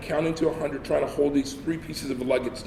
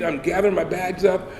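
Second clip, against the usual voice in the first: usual speaking voice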